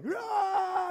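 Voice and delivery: high, drawn-out war cry; imitated battle yell. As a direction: charge like a mock Braveheart army.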